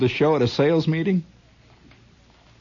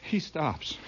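ordinary talk, nothing marked

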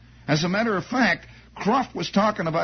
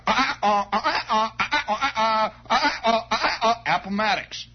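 higher staccato uh-uh bugle imitation; mock bugle call; 4 semitones higher than usual. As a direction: toot it out like a bugle with the voice. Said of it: "Listen to this one".

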